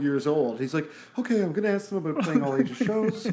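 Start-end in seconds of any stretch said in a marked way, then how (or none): 1.2-3.3 lightly voiced Swedish twelve-year-old, a bit higher; mock Swedish boy journalist, eager; Swedish kid accent. Mocking the fanzine kid in a light Swedish accent. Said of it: "This is my Swedish twelve-year-old accent".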